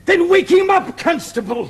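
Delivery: high, very loud sergeant's shout; exasperated order. Acted. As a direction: bellow the order at your constable. Sergeant orders his constable.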